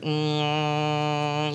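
long, steady hummed drone; tattoo gun buzz. Retold or imitated. Showing how the tattoo gun sounded, droning buzz. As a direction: imitate a buzzing tattoo gun.

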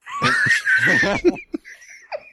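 sudden shrieking burst of laughter; loud laugh. Real, not acted. Laughs at muted mic.

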